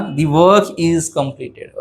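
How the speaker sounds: very slow, word by word; slow dictation. Shown as a demonstration. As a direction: model it slowly for learners. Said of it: "काय म्हणणार?". (हळू, शब्द-न-शब्द)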